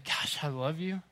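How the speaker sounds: a soft, hushed loving sigh; quiet fond affection; tender. Retold or imitated. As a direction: hold them close and say it gently. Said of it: "wrap my arms around them and say".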